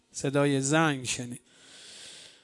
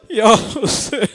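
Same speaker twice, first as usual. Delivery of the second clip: louder, higher wailing cry; second cry, rising into a lament; anguished cry; 5 semitones higher, 7 dB louder than usual. A narrator's reading. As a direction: let the name break out in a wail. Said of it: "سه بار فریاد زد".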